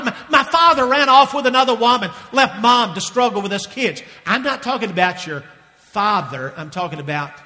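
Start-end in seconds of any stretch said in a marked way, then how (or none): 0.0-4.0 raised, louder voice of objection; pained protest. Acted. Congregant objects to Keith about runaway father, pained protest. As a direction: protest as an aggrieved listener. Acted objection from wounded listener.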